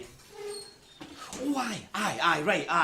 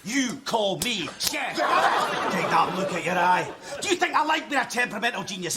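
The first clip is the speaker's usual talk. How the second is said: ordinary talk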